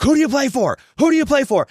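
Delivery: high, loud drill-sergeant yell; retold coach yelling. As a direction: bark it at worn-out players over and over. Coach drilling exhausted players, retold.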